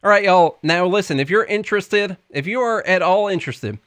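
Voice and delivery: folksy Southern pitchman; Tennessee accent. Pretend. Co-host pitches the stock market class, mock Tennessee accent. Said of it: "all the videos on the website are in the same voice".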